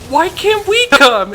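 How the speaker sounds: put-on high, loud childish whine; whiny kid sister. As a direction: plead like a little girl left out. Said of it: "and she's like".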